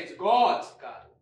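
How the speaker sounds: GOD'S said loud, with a falling tune; grand declaration. Acted. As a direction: proclaim it like a revelation. Tiger builds up garden.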